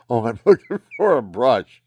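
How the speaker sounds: laughing. Retold or imitated. Retells finding what he sought.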